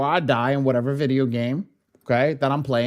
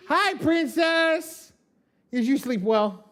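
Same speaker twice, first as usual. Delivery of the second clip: much higher, slow, drawn-out hello; sing-song greeting; 13 semitones higher, slower than usual. Real, not acted. Dad greeting his daughter coming downstairs, high and sing-song.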